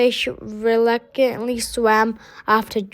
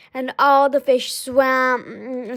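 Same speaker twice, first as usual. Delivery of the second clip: usual speaking voice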